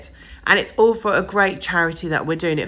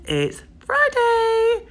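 sing-song; 5 dB louder, much slower than usual. Real, not acted. Sing-song Friday announcement.

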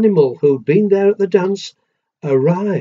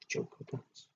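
hushed breathy whisper of the title; whispered aside; 4 semitones lower, 25 dB quieter than usual. Real, not acted. Parent whispering the title to the listening child, hushed. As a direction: whisper it like a little secret.

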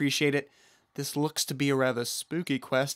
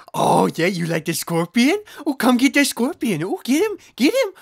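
squeaky egging on; 7 semitones higher, 6 dB louder than usual. Real, not acted. Egging on the pet.

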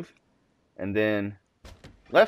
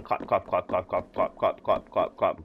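low, even clop-clop noises; imitated footsteps; 5 semitones lower, 6 dB quieter than usual. Player mimicking his own steps while spying, imitated footsteps.

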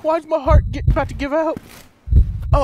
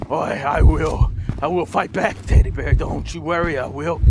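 mock heroic vow; 8 semitones lower than usual. Player vowing to save his teddy friend, low mock heroic speech. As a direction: swear loyalty like a hammy hero.

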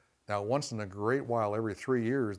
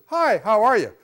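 bright, much higher and louder hello; cheery greeting; 9 semitones higher, 10 dB louder than usual. Acted. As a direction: greet them with loud, sunny cheer. Acted greeting perceived by anxious person.

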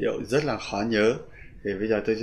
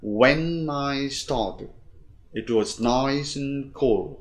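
slow beats on "when", "started", "nice", "cool"; four-stress model reading, "when" held long; rhythm demo; 3 semitones higher, much slower than usual. Shown as a demonstration. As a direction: stretch "when", then link "nice and cool". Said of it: "chúng ta đọc lại cái nhịp đó".

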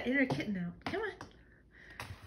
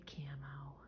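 whisper; 9 semitones lower, 15 dB quieter than usual. Real, not acted. Cat name whispered.

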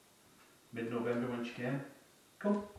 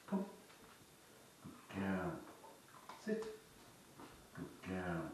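gentle commands; 8 dB quieter, much slower than usual. Shown as a demonstration. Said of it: "Gentle commands".

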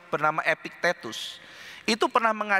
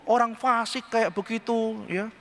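ordinary talk, nothing marked

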